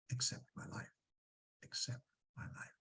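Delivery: breathy whisper of the phrase; whispered echo. Acted. Teacher echoing Hamlet's line in a whisper. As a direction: whisper it like an afterthought.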